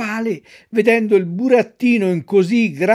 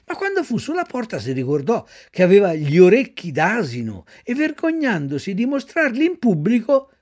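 ordinary delivery

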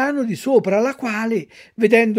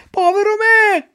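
much higher, louder povero me cried out; anguished shout; 13 semitones higher, 6 dB louder than usual. Acted. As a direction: let the second povero me burst into a wail. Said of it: "gridò Pinocchio".

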